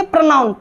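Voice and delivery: "प्रोनाउन" as pra-naun; no O sound at the start of "प्रोनाउन"; correct pronunciation. Shown as a demonstration. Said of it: "प्रोनाउन बोलेंगे". ("प्रोनाउन" की शुरुआत प्र से)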